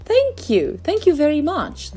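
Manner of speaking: very high, playful little fish; bubbly thanks. A narrator's reading. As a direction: burst with happy gratitude. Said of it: "bubbled playfully".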